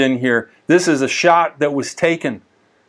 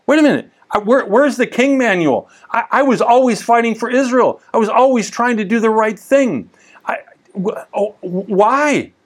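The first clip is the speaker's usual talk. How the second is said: higher-pitched, baffled pleading that stumbles to a halt; bewildered protest; 6 semitones higher than usual. Acted. David protesting to God that he always fought for Israel, bewildered. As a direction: protest in disbelief, words tripping over themselves. Acted reaction of stunned David.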